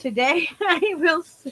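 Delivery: giggling through the words; can't stop laughing; laughing while reading. Real, not acted.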